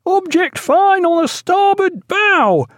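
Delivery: very high, slow lookout's hail; distant-carrying, drawn-out high call; hail from masthead. A narrator's reading. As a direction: sing it out long and high to the deck below. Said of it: "the hail from the masthead".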